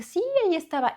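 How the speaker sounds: bright reply. Retold or imitated. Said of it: "y le dije".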